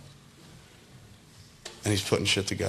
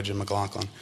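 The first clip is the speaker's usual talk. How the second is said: ordinary delivery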